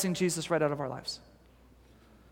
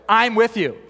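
reassuring; 3 semitones higher, 5 dB louder than usual. Retold or imitated. God reassures Moses.

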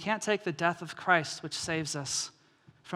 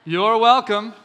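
smug; 3 semitones higher, 10 dB louder, slower than usual. Acted. Smug rescuer mock.

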